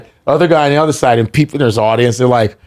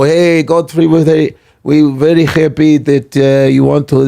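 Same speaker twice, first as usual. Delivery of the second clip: put-on light broken-English Russian accent; fake Russian accent. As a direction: put on a mild Russian accent for the festival organiser. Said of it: "that's a great fake Russian".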